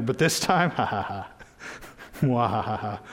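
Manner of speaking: mock evil mwahaha cackle; hammed-up villain laugh; sneering panto villain laugh. Mock villain laugh over anger.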